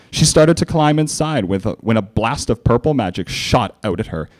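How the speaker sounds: plain reading voice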